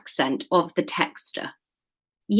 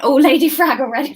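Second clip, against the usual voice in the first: usual speaking voice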